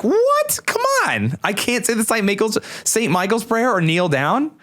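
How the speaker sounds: high-pitched squawk of disbelief, easing down; exasperated, voice shooting way up; incredulous protest. Acted. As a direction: protest as if you can't believe it. Acted reaction to bishop's refusal.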